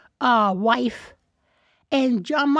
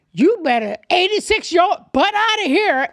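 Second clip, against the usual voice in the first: loud, high, forceful order; performed script line; 6 semitones higher, 7 dB louder than usual. Acted. Student reading the script on stage, loud and commanding. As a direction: belt it out like an acting audition. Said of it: "when I came up to do it".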